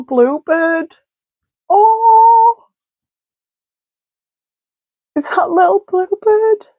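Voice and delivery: high, gushing delight. Real, not acted. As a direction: squeal it high over a sweet little bluebird. Delighted at the bluebird card.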